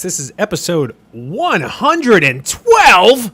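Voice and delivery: slow, drawn-out announcer intro. Acted. Host announcing the episode in a slow big announcer voice. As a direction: boom it out like a radio host.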